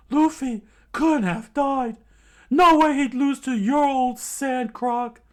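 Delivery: shouted, high and loud; defiant yell. Acted. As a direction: scream it back in defiance. Usopp defiant yelling.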